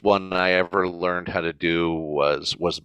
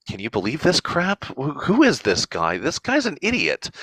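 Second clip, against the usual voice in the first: higher, incredulous aside to the crowd; exasperated aside; 7 semitones higher than usual. Retold or imitated. Dracula mocking Edward to the audience, higher and exasperated. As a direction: roll your eyes at the audience. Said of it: "looks that kind of read".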